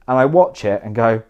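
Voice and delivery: ordinary talk, nothing marked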